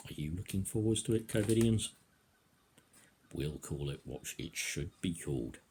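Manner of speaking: ordinary talk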